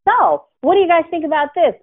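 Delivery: high, bright mock host asking the group; perky host prompt. Host asking co-hosts about personal growth, high mock-cheery. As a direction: chirp the question like an eager host.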